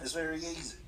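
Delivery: high-pitched Australian "easy"; Australian accent. Shown as a demonstration. Showing how easy it is, Australian accent. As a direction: show off an Aussie accent. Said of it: "I can do an Australian accent".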